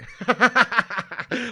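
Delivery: hearty burst of laughter; rapid run of laughs; real laugh. Real, not acted. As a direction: crack up at your own jibe. Laughs at his own jibe.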